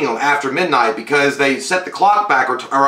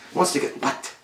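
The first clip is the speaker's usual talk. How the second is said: hushed, unvoiced whisper; 3 semitones higher, 9 dB quieter than usual. Shown as a demonstration. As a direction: breathe it like a secret. Repeated line, whispered take.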